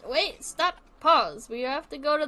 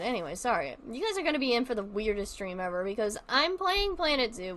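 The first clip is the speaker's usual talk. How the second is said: ordinary delivery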